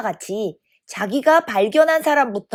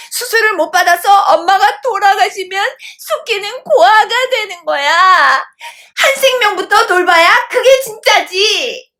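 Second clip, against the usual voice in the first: high, loud tearful pleading; tearful insistence; 9 semitones higher, 4 dB louder than usual. A narrator's reading. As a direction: argue as if close to tears, voice pushed right up. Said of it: "눈물까지 글썽이며".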